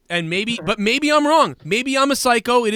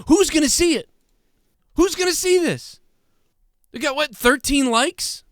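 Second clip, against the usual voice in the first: much higher, mocking disbelief; scoffing at the idea anyone noticed; incredulous rant; 8 semitones higher than usual. Real, not acted. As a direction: squeak up in disbelief. Incredulous rant about tiny tweet.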